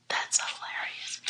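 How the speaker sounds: amused whisper, as if sharing a secret; whispered aside. Real, not acted. Reader laughing off NASCAR, in a whisper. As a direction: lean in and whisper it, tickled.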